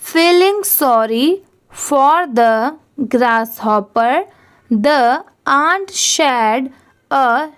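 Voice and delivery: very slow, word-by-word reading for learners; slow careful reading aloud. Shown as a demonstration. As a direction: read it slowly, pausing between every phrase. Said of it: "एक बार इस paragraph को भी read करके देखते हैं". (बहुत धीरे, शब्द-शब्द रुककर पढ़ना)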